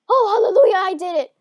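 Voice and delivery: high, loud hallelujah; loud cry of relief; jubilant relief. Real, not acted. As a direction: burst out in sudden triumph. Relief at finally landing.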